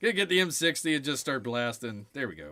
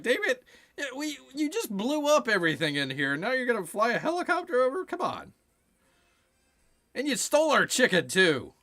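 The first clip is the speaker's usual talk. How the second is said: much higher, mock indignant villager; angry villagers; 9 semitones higher, 3 dB louder than usual. Villagers complaining the hero blew everything up, mock outraged. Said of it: "the villagers are like".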